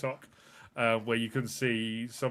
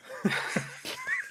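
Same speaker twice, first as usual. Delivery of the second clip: high squeal of laughter; shrieking laugh. Real, not acted. Shrieking as the podcast wrap-up falls apart. Hosts crack up at joke.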